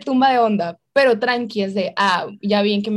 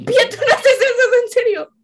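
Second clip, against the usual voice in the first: laughing; 11 semitones higher, 4 dB louder than usual. Real, not acted. Laughing disbelief at Pía.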